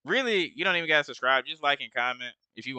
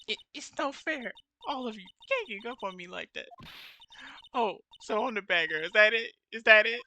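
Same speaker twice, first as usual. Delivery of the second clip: shrill cornered witness; 8 semitones higher than usual. Acted. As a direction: snap back like a spoiled girl caught out. Witness April May snaps at lawyer.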